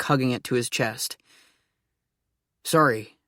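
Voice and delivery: ordinary delivery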